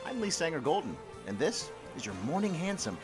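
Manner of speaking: usual speaking voice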